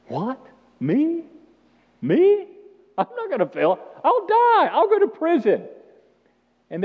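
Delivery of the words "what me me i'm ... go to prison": high, loud indignant protest; a cocky disciple, scandalised at the idea; arrogant Peter. Retold or imitated. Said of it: "Peter says the most arrogant of all things".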